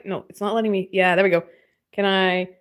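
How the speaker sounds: normal talking voice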